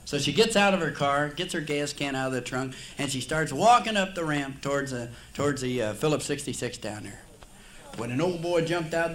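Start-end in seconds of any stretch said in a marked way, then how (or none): none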